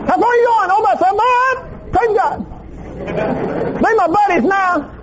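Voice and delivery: very high, squeaky cartoon-dog voice; friendly little dogs. Little dogs greeting their jogger buddy, high comic voice. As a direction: chatter like tiny talking dogs. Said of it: "they come out and they go".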